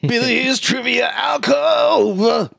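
high, drawn-out mock announcer call; hammed-up, slow high-pitched title holler; segment announcer shout. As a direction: belt out the segment title, stretching it.

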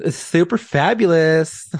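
mock fabulous. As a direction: ham it up like a showy florist. Mock florist flair.

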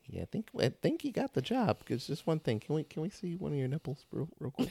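hint of a casting director making an awkward request; mock casting director. Acts casting director addressing an actress.

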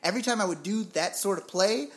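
ordinary talk

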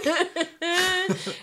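high squealing laugh; high-pitched laugh. Real, not acted. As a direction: burst into a shrill giggle. Laughing at the candle joke.

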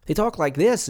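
high-pitched mock Midwestern accent, almost Irish. Shown as a demonstration. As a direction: put on a near-Irish Midwestern lilt. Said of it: "they almost sound Irish".

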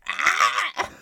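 sudden high, loud mock scream. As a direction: yell like the weird neighbour. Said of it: "it's like".

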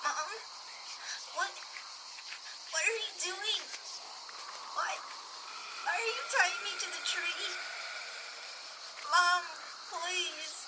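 put-on high, panicked child's plea; terrified child. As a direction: beg in terror. Child tied to tree, terrified.

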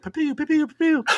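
playful shooting sounds; high gun sounds. Retold or imitated. Shooting rivals from the tower, making high pew noises.

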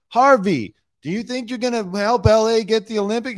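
high, loud, eager question, mock reporter; eager interviewer. Interviewer pressing Harvey about the Olympics, high and eager. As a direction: pitch the question up like a keen TV reporter.

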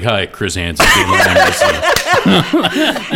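hammed-up news-show greeting, laughing; Chris Hansen. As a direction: introduce yourself like a TV reporter, drawing out the end. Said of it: "I'll be like".

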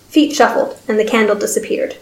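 plain reading voice

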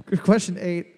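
usual speaking voice

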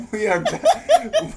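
high laughing voice; words breaking into laughter. Real, not acted. Laughing mid-sentence.

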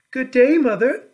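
put-on high, loud young princess voice; a bright high princess calling a greeting. A narrator's reading. Princess greets old woman.

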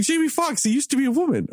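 high, insistent mimicry of her claim; lightly mocking imitation of a friend; mimicking friend.

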